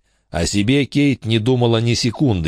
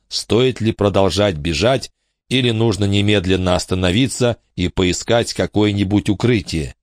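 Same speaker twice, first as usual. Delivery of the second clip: ordinary delivery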